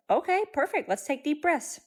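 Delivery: put-on high sing-song praise to a calming toddler; encouraging mom voice. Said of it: "I was like".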